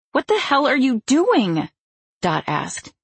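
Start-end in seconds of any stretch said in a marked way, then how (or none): 0.1-1.7 high, outraged demand; shocked anger. A narrator's reading. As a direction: blurt it out furious. Dot shocked at accusation.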